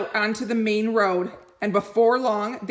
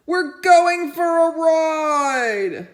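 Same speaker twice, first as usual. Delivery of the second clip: high, loud and slow, thrilled; eager dog voice; 9 semitones higher, 7 dB louder, much slower than usual. Acted. Dog thinking about the ride, slow and delighted. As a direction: stretch out the joy of the ride.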